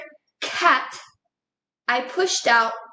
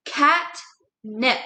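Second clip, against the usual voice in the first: catnip as two slow, separate breaths; split into syllables. Shown as a demonstration. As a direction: say cat, pause, then nip for catnip. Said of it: "I'm pushing out two breaths".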